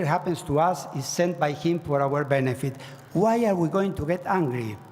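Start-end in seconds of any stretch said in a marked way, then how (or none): none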